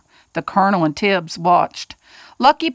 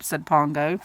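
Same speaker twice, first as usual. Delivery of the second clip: ordinary delivery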